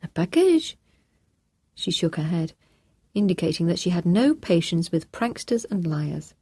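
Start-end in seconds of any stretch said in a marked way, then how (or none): 0.0-0.8 put-on high, scornful French-accented echo; the housekeeper scoffing at the prank; much higher, incredulous housekeeper; housekeeper's disbelief. A narrator's reading.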